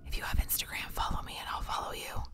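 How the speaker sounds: soft, voiceless whisper; whispered aside. Real, not acted. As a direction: slip it in under your breath. Whispered aside amid loud pet talk.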